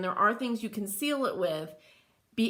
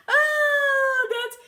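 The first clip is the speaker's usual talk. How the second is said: real squealing laughter, high and loud; laughing; 7 dB louder than usual. Real, not acted. Host laughing at a viewer's joke about her accent. Amused by Make [name] say draws.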